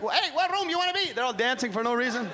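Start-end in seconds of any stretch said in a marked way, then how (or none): 0.0-1.1 high mock Miami accent; Miami hotel guy. Comic imitating the Miami hotel clerk in a high mock Miami accent. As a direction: ask it like a breezy Miami clerk. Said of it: "he's like".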